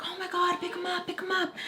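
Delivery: high, urgent mock panic; freaking out. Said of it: "She starts freaking out".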